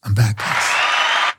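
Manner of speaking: slow, breathy, hushed "I'm back, guys"; slow hushed return. Pretend. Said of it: "Say it again".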